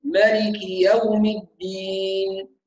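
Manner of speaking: مالك يوم الدين chanted slowly with every vowel complete; full vowels. Shown as a demonstration. Teacher reciting مالك يوم الدين for students, slow and complete. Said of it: "لا بد من إتمام الحركات جيدا".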